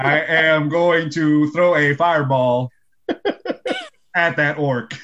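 slow, measured presidential cadence; deliberate oratory with a long pause; Obama. Acted. Wizard player attacking an orc, slow mock-presidential voice. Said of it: "an Obama impression".

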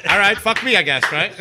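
loud, high resigned groan; mock defeat. Regular giving up on counter man, mock resigned. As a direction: give up with a laugh. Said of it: "I'm like".

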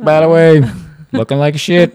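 loud, high mock heckle; yelling young man. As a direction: shout it like a passing jerk. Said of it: "a young man yelling at her".

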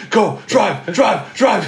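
retold high, urgent yelps; panicked orders to the driver, half laughing; freaking out. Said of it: "Draymond was freaking out".

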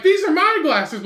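high, slow mock insistence; retold bickering. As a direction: act out a stubborn claim in a squabble. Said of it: "they were just arguing back and forth".

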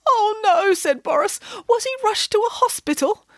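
high, tearful alarm; a bear fretting aloud; alarmed cry. Acted. Boris the bear worried for the prince, high alarmed cry.

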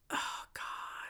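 breathless whispered oh God; whispering. Real, not acted. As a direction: whisper it under your breath. Embarrassed aside.

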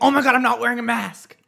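sudden high gasp of alarm; shocked. Retold or imitated. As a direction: realize it in a flash of panic. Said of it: "I was like".

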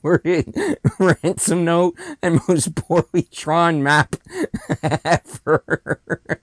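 drawn-out words breaking into laughs; amused, laughing. Real, not acted. Co-host mocking a badly written ransom note, laughing.

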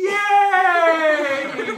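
long, loud, high-pitched "yay"; fake cheer. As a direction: overdo a drawn-out cheer. Faking joy over the pajamas.

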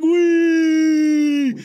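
high, long-held cartoon squeal; gleeful whee. Friend imitating Patrick floating down, high gleeful whee. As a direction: sail down on one high held note. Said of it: "He's like".

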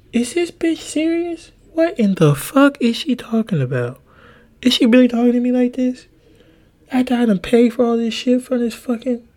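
high-pitched, disbelieving mock outrage; incredulous outrage. Replays disbelief at ex-girlfriend's words.

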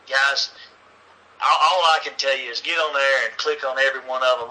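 usual speaking voice

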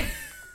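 high burst of laughter; high squeal laugh. Real, not acted. Friend teases her, she squeals with high laughter.

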